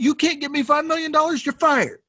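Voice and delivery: high-pitched indignant rant; mock outraged tirade. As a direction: retell an outburst, high and worked up. Acted angry firing rant in story.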